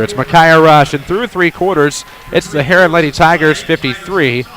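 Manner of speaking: high, loud thrilled call; excited. Real, not acted. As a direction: still buzzing from the big shot. Right after the buzzer three.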